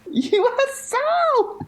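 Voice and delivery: high, drawn-out squeal of the letters; delighted exclamation. Real, not acted. Cheering co-host's new joke term, delighted high exclamation. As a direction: stretch it out in thrilled delight.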